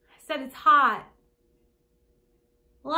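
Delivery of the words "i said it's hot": raised voice for someone who missed it; louder repeat. Acted. Visitor repeating herself to Gran, louder. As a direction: repeat it loudly to be heard.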